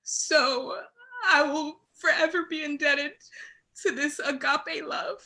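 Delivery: fighting back tears on every word; telling how God's love moved her, on the verge of tears; tearful. Real, not acted. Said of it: "I'm getting emotional talking about this".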